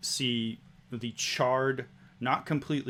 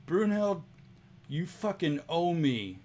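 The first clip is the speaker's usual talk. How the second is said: annoyed demand; 6 semitones higher than usual. Acted. Istrid demands payment.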